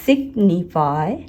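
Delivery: Signify slowly as सिग, नि, फाई; slow syllable-by-syllable signify; three separate syllables. Shown as a demonstration. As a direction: break signify into three beats. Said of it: "ये तीन syllable word है".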